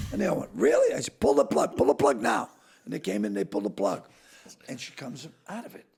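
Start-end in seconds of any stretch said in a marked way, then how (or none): 0.5-0.9 high, loud really; shocked high question; stunned family asking; disbelieving. Retold or imitated. 1.2-2.5 urgent order. Retold or imitated.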